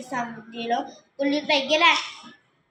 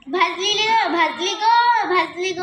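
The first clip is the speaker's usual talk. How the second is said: burnt cry; 4 semitones higher, 4 dB louder than usual. A narrator's reading. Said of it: "असं म्हणत पळाल्या".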